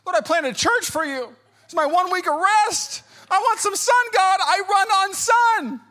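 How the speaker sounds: high, loud tantrum at God; mock angry complaint. Rained-on pastor complaining to the Lord on vacation, mock angry. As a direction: wail it like a hammed-up tantrum. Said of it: "first two days, I'm angry".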